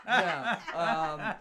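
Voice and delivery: laughing through the no. Real, not acted. Warlock player laughs at the shopkeeper's joke.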